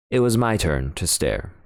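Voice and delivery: usual speaking voice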